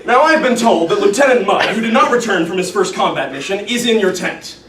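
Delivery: stern officer reading out charges; indignant commander's formal indictment; Colonel Cathcart, accusing. Acted. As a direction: pile up the accusations with military pomp. Plays Cathcart berating Yossarian.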